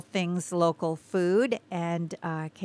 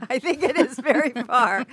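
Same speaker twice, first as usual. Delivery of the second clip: laughing; 7 semitones higher, 6 dB louder than usual. Real, not acted. Joking about the universe's edge.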